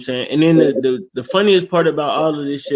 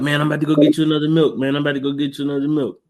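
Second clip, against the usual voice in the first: fast, hurried apology; mock flustered kid; nervous backing down; faster than usual. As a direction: rush it out to avoid a fight. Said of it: "He like".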